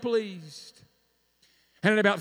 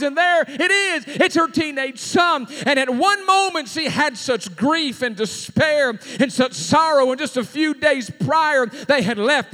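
usual speaking voice

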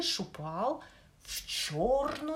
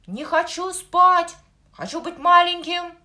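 high, loud repeated yelling; tantrum shout; 10 semitones higher, 15 dB louder than usual. Acted. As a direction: shout it like a kicking toddler. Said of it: "всё время повторял". (капризный крик)

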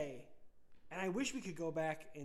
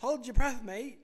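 louder, Aussie-tinged hold your breath mate; playful fake Australian accent; mock Australian accent; 6 semitones higher, 8 dB louder, slower than usual. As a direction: throw on an Australian twang. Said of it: "I was like".

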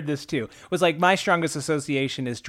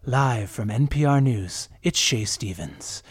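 slow, measured radio sign-on; unhurried, lower news announcer voice; N P R newscaster Shay Stevens; 4 semitones lower, 3 dB quieter, slower than usual. Retold or imitated. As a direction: imitate a smooth newscaster opening the bulletin. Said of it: "she starts with".

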